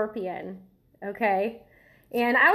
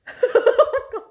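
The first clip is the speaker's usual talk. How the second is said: high, loud nervous cackle; real jittery laugh; 8 dB louder than usual. Real, not acted. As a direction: burst out laughing from nerves. Nervous laugh before first bite.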